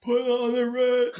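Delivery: high, slow, strained monster voice; monster impression. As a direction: sing it like Frankenstein's monster. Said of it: "that was my monster impression".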